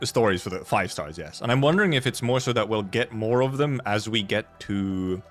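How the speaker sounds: normal talking voice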